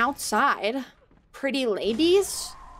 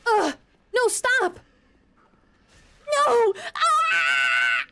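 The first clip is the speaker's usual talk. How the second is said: panicked scream; 11 semitones higher, much slower than usual. Real, not acted. Streamer screaming while an enemy nearly kills her. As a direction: shriek as if about to die. Attacked, about to die.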